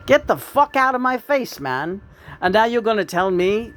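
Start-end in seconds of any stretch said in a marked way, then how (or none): 0.1-2.0 angry dismissal. Real, not acted. Telling off a critic.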